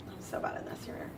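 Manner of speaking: barely voiced, under-the-breath aside; whispered aside. Real, not acted. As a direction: whisper it to yourself, self-critical. Muttered self-critical aside while writing.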